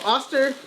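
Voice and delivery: "Oster" with an open "ah" first vowel; "Oster" begun like "awesome"; mistaken pronunciation. Real, not acted. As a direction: say "Oster" as "AH-ster". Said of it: "We've been saying it wrong".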